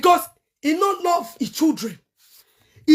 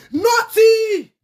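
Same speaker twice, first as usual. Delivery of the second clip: loud furious nothing; 5 semitones higher, 5 dB louder than usual. Real, not acted. Angry rant about the father.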